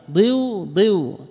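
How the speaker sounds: ضيو twice as "diyuu", the first pitched high; demonstrated syllable. Shown as a demonstration. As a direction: sound out ضيو with i gliding into long u. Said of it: "سكنت الواو وكسر ما قبلها".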